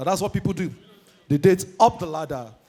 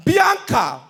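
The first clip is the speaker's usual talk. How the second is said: usual speaking voice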